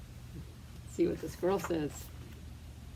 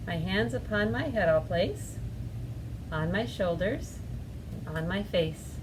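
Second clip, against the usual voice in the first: put-on slow, measured children's rhyme; rhythmic recitation with pauses for the motions; finger-play rhyme; slower than usual. Shown as a demonstration. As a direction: lead little ones through the actions, stretching key words.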